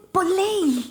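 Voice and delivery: high-pitched, incredulous mum protest; retold dismay. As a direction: re-enact a mother's baffled objection. Mother reacts to eight percent result.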